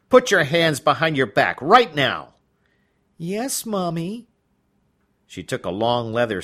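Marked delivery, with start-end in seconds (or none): 0.1-2.3 loud, sharp command; commanding order. Acted. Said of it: "She quickly added". 3.2-4.3 put-on high, slow baby voice; meek childish reply. Judge answers in baby role.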